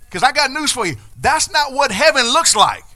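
loud high preacher's cry; full-force preacher's climax; preaching at full force. Acted. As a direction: lift the congregation with a big shout.

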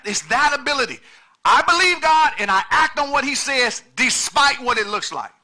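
loud, high, driving preacher's cry; preaching at full force. Acted. Pastor pressing the congregation on currency, full-force preaching.

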